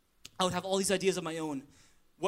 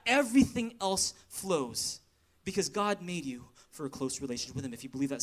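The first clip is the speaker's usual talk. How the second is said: usual speaking voice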